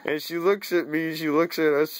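usual speaking voice

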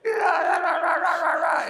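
high, loud hammed-up coach bellow at the players; angry coach yelling. Imitating an angry coach yelling at his defensive backs. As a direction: yell like a furious coach at practice. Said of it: "he's getting mad".

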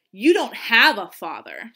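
loud, cruel taunt; scornful put-down; sneering. A narrator's reading. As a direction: jeer it in her face. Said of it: "they sneered".

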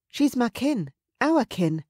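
high, protective female voice; anxious pleading. Acted. Mother shielding the girl from the raider, anxious.

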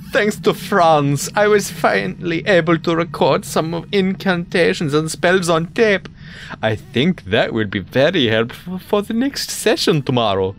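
hammed-up German accent, reading aloud; bad German accent. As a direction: read it in a terrible mock German accent. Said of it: "the worst German accent I could ever do".

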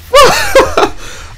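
high squealing laugh. Real, not acted. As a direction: burst into a shrill giggle. Laughing after the outburst.

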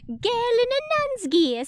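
high, loud Cockney policeman; hammed-up high Cockney voice; Cockney accent. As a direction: put on a bad high Cockney. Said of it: "I can't do a Cockney accent".

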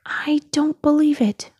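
high-pitched storybook lament. A narrator's reading. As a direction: wail in disbelief, lightly acted. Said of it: "wailed Chick Hicks".

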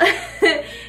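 high laughing burst; real amused laugh; burst of laughter. Real, not acted. Mother laughing at son's joke.

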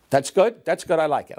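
loud, high-pitched approving outburst; emphatic approval. Retold or imitated. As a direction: approve it at last, with gusto. Acted approval after many retakes.

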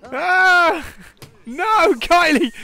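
high, loud, slow laughing yell; high drawn-out laughing protest; laughing shriek. Real, not acted. Laughing panic at Kylie stripping.

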